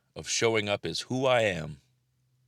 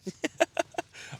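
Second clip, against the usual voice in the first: squeaky laugh. Real, not acted. Laughing at the dog.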